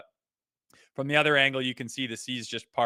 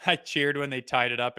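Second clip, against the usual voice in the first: ordinary talk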